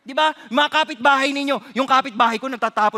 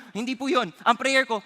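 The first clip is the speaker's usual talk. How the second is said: normal talking voice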